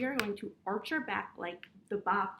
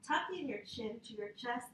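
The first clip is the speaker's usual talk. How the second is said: ordinary talk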